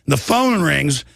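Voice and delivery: ordinary delivery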